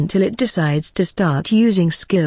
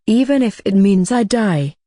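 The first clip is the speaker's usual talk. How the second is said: usual speaking voice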